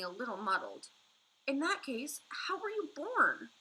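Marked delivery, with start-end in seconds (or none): 1.5-3.5 high, slow whisper. A narrator's reading. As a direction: whisper the question. Sophie asks, whispered.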